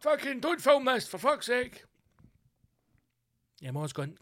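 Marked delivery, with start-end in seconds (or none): 0.0-1.8 high, exasperated snap; annoyed dad. Retold or imitated. Dad in the car snapping at the family. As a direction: scold the kid filming. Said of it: "the dad's like".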